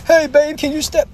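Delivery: high, slow whiny plea to his babe; whiny scared guy. Retold or imitated. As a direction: beg like a man scared of a spider. Mocking a guy scared of spider.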